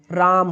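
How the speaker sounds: "राम" with a long held A; extra-long vowel. Shown as a demonstration. Teacher showing an extra-long vowel, stretched out. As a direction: draw out the middle vowel of "राम". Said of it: "में ज़्यादा समय लग रहा है".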